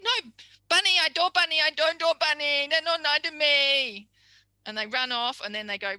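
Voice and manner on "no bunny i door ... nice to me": much higher and louder, insisting "I door bunny"; a hurt kid correcting you; frustrated child. Retold or imitated. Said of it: "come running up to you and go".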